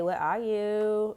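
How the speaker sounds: mock lovelorn call. Longing call for a missing partner.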